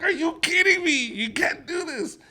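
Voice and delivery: high shrill disbelief; outraged mom. Retold or imitated. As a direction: shriek at the husband. Mom shrieking at dad.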